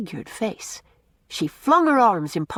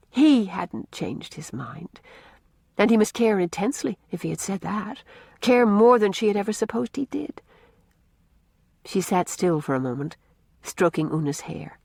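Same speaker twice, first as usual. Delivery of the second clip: ordinary delivery, nothing marked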